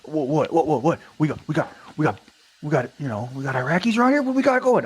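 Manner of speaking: high, stammering, climbing major voice; mock a major spluttering in disbelief; flustered major. Acts flustered major demanding answers.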